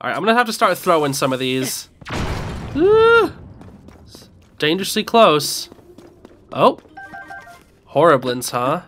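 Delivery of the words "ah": startled yelp. Real, not acted. Startled in combat.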